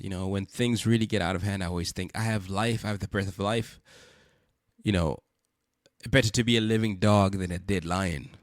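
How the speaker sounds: ordinary delivery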